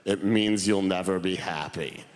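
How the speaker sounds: low, dry deadpan grandfather; gloomy grandfather. Retold or imitated. Grandfather deadpans to grandson, low and dry. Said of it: "He went".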